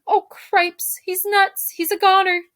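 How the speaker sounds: high, panicky mouse yelling; panicked shouting. A narrator's reading. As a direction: yelp it in rising alarm. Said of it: "shouted Ferlo again".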